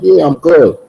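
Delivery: high, insistent retold reply; high-pitched reassurance. Answering his cousin after being shot, high-pitched reassurance. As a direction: pitch it up, brushing off concern.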